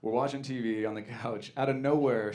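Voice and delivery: ordinary talk, nothing marked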